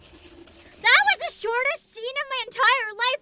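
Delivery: loud, slow, high exasperated outburst; outraged rant. Real, not acted. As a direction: vent in disbelief at the game. Ranting at abrupt scene end.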